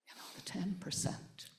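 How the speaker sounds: breathy hush, barely audible; whispered. Real, not acted. Whispering the ten percent to the audience at the peace talk. Repeats phrase for emphasis, hushed.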